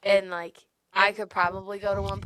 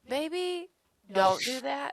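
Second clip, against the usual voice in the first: high, timid little voice, half laughing; a sheepish suggestion, pitched way up; mock meek Roku; 7 semitones higher, slower than usual. Friend imitating Roku gently objecting, high and sheepish. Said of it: "Roku's like".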